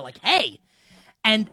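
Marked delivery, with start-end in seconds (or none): 0.2-0.6 a sharp, higher mock "Hey"; reenacting yelling at his toddler to stop her falling; retold yell. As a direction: snap out a warning hey, pitched up. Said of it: "And I, like, yell at her".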